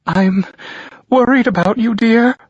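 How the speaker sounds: mother breaking into tears; crying mother. A narrator's reading. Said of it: "I hear her cries thicken".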